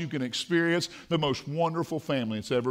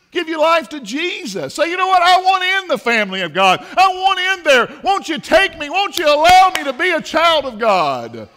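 high, loud pleading from the pulpit; full-force preaching; 10 semitones higher, 8 dB louder than usual. Real, not acted. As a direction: cry out the plea like a preacher.

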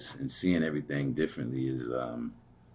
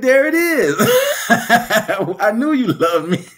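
much higher, louder, laughing slowly; laughing triumph; 12 dB louder, slower than usual. Retold or imitated. To the man who finally said it, laughing triumph. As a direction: gloat with delight.

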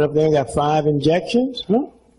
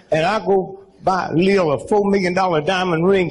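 normal talking voice

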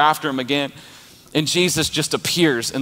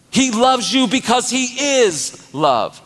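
full-force preaching; 8 semitones higher than usual. Acted. Pastor rallying the congregation, preaching at full force. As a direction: preach it hard to the crowd.

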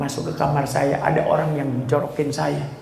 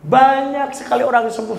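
ordinary delivery

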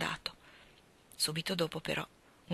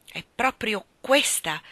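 ordinary delivery, nothing marked

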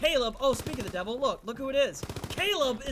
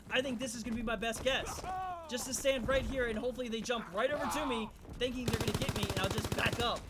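ordinary delivery

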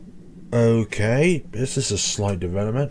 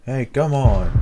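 louder, urgent call; urgent plea; 3 semitones higher, 7 dB louder than usual. Real, not acted. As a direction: push her to wake up. Urging sick friend.

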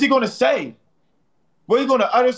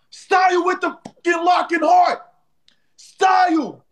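hype shout; 7 semitones higher than usual. Real, not acted. Cheering Style's gift on the live, high excited shout. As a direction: yell the name like a crowd.

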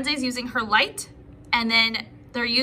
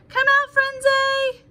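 higher, slow, stretched call across the garden; long drawn-out call; 12 semitones higher, 5 dB louder, slower than usual. Acted. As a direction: sing it out slowly, like searching far away. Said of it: "shouted Legs".

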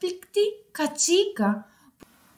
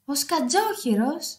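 ordinary delivery

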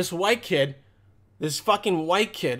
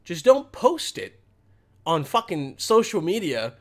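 ordinary talk

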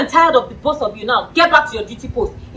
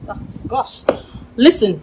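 ordinary delivery

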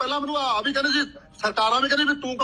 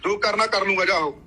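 louder, daring him to speak; loud taunting; 4 semitones lower, 5 dB louder than usual. Real, not acted. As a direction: challenge him loudly, go on then. Taunting rival in live argument. (ਲਲਕਾਰਦੇ ਹੋਏ ਉੱਚੀ ਆਵਾਜ਼)

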